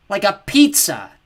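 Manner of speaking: slow, loud oafish voice; dim brute. Acted. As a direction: add it proudly like a simpleton. Cal adding proudly.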